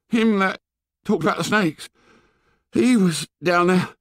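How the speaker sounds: high-pitched gasped words, broken by pauses; gasping. A narrator's reading. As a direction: gasp it out high, fighting for breath. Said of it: "he was gasping for breath".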